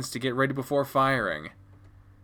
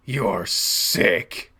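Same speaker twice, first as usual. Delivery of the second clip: disgusted; 4 semitones lower than usual. Acted. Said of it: "Dad looks downright disgusted now".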